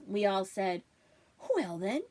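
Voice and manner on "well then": hopeful. Acted. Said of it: "she offered hopefully".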